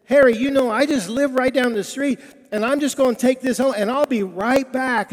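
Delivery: high, loud, eager rush; excited. Retold or imitated. Agnes telling Harry she'll take the cake home, excited.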